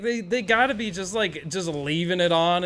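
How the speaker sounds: ordinary talk, nothing marked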